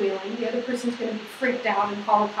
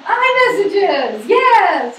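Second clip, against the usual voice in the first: higher, louder, slow cheer of the answer; enthusiastic answer; 7 semitones higher, 8 dB louder, much slower than usual. Real, not acted. Teacher cheering the answer to her own question, excited. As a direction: proudly cheer the answer to the class. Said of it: "You're gonna write what?".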